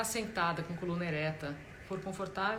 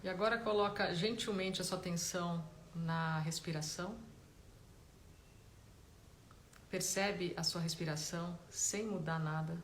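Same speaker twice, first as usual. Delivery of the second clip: guided meditation; 7 dB quieter than usual. Real, not acted. Meditation teacher guiding listeners to notice body and breath, calm guided-meditation voice. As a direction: gently guide them inward, leaving space between phrases.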